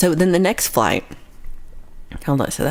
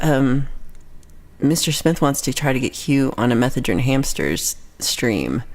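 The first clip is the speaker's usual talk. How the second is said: ordinary talk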